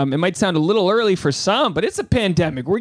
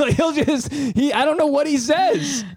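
high-pitched, cracking up mid-sentence; laughing; 12 semitones higher than usual. Real, not acted. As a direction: lose it laughing, voice shooting way up. Cracking up at his cousin bit.

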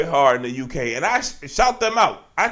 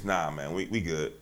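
low mutter; 11 semitones lower, 15 dB quieter than usual. Real, not acted.

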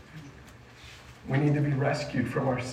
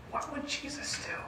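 high, very soft near-whisper; soft, high-pitched awe; hushed wondering; 10 semitones higher, 13 dB quieter than usual. Retold or imitated. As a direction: ask it in a hush, like an onlooker in suspense. Voicing watchers' hushed suspense.

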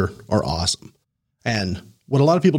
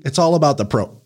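ordinary talk, nothing marked

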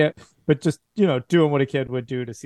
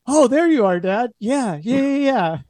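put-on high, bright-eyed child voice, much higher; a little boy gushing to mom and dad; excited kid Amos; 11 semitones higher, 4 dB louder than usual. GM voicing the boy Amos to his parents, high excited child voice.

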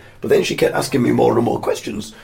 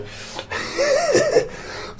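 breathless rising giggle; a helpless high laugh; high squeaky laugh. Real, not acted. Laugh at own predicament.

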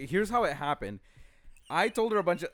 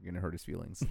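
low, much softer confidential warning; hushed aside; 10 semitones lower, 11 dB quieter, much faster than usual. Retold or imitated. Sister warns privately about his feelings, hushed. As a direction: drop it right down, for one listener only. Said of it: "she pulled me aside".

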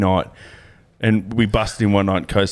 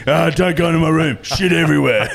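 much higher mock warning voice; imitated teammate; 8 semitones higher, 3 dB louder than usual. Imitating a mate warning about his room, high comic call. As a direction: play the mate calling out a messy warning.

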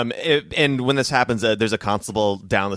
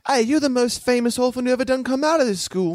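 put-on much higher, excited little kid; eager orphan kid; 14 semitones higher than usual. Orphan kid gushing to Amadeus, high and eager. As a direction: gush like a fan.

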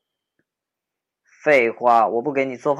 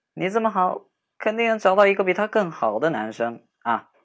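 higher, gentle reassurance; reassuring; 7 semitones higher than usual. Acted. Friend reassuring dumped girl she'll do better, gentle reassurance. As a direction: lift her up with sincere praise.

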